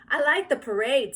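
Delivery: put-on high, loud eager boy; little boy. A narrator's reading. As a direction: blurt it out like a kid. Howie talking.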